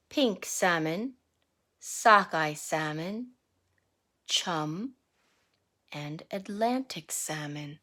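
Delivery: rhythmic picture-book read-aloud; reading to children. Acted. Teacher reading a salmon picture book to the class, sing-song read-aloud. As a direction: read the verse to the class with a lilt.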